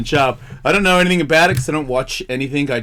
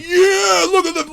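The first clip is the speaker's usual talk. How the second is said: mocking whine; 13 semitones higher, slower than usual. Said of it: "And you're like".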